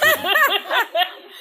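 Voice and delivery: high loud cackle; high laugh. Real, not acted. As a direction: cackle at the joke. Laughs during joke.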